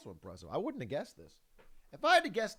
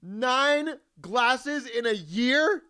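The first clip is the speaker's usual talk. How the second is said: higher, louder and very slow disbelief; incredulous; 6 semitones higher, 7 dB louder, much slower than usual. Real, not acted. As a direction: stretch out the question in disbelief. Incredulous reaction to video fact.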